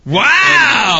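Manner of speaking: loud, high, amazed outburst; astonished wow. Real, not acted. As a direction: blurt out a big high wow. Reacts to the price.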